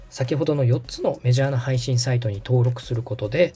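ordinary talk, nothing marked

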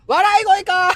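high, loud burst of realization; sudden loud high outcry; loud exclamation. Real, not acted. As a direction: blurt it out, about to laugh. Bursts out on realizing, then laughs.

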